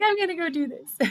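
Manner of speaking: lightly voiced excited friend; mock eager voice. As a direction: tease a friend's big plan.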